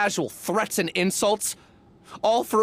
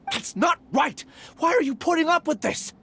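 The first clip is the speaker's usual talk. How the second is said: higher, raised protest; indignant outburst; 6 semitones higher than usual. Acted. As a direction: burst out in indignation at the injustice. Angry outburst over stolen research.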